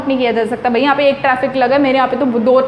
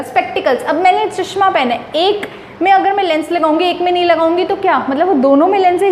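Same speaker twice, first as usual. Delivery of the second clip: ordinary talk, nothing marked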